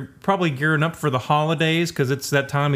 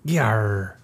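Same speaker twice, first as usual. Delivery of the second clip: pirate growl; 6 semitones lower than usual. Pretend. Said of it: "Or Talk Like a Pirate Day".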